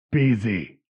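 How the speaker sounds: gruff voice from behind a door; a scratchy, muffled dismissal. Acted. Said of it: "you hear a muffled, scratchy voice go".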